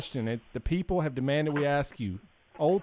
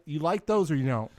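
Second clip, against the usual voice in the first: ordinary talk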